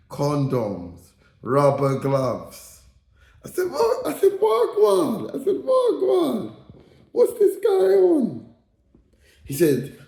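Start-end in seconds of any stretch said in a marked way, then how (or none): 3.7-4.1 very high squeaky disbelief; mock shock. Reacting to finds in this guy's flat, high mock-shocked squeal. 4.4-5.3 very high squeaky disbelief; mock shock. Reacting to finds in this guy's flat, high mock-shocked squeal. 5.6-8.5 very high squeaky disbelief; mock shock. Reacting to finds in this guy's flat, high mock-shocked squeal.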